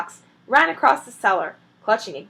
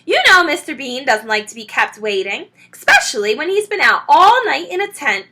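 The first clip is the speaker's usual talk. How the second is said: loud, higher, hurrying someone along; impatient call; 5 semitones higher, 9 dB louder than usual. A narrator's reading. Mrs Bean hurries Mabel from upstairs, loud nagging call. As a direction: nag from upstairs. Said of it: "the voice called".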